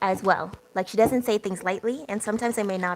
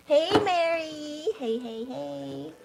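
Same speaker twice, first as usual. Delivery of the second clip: drawn-out greeting; 7 semitones higher, much slower than usual. Real, not acted. Host greets Mary arriving in the live chat, drawn-out high greeting. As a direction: sing out a long, slow welcome. Greeting a viewer joining the stream.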